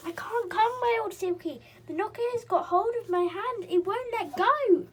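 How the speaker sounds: high, loud, frightened cry; panicked. Acted. Silky stuck on the knocker calling her friends, high frightened cry.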